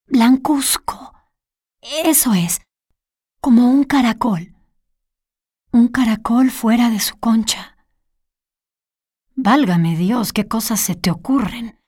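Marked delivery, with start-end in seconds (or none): 0.1-7.8 lightly voiced Olga. Olga describing the pale stranger to the detective, lightly hushed.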